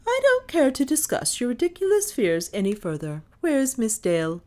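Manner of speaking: high, emphatic grand-lady dismissal; imperious. A narrator's reading. Mistress changing subject to Miss Dale, imperious. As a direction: close the discussion with authority. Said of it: "had the Van Gorder manner on".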